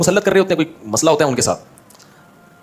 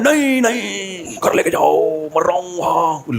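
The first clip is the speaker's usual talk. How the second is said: mock dying wail; much slower than usual. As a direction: play the dying victim, hammed up. Fake injured man refuses hospital.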